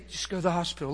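soft, hushed inner voice; a quiet hint of the Holy Spirit speaking; impression of the Spirit. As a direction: murmur it like a gentle voice inside. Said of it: "A little impression".

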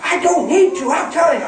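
fired up. Retold or imitated. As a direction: imitate a fired-up woman, high and insistent. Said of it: "She's fired up".

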